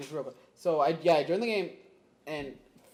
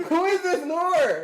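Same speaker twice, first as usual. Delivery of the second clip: baffled question; 12 semitones higher, 9 dB louder, much slower than usual. Retold or imitated. Baffled by friend's talk.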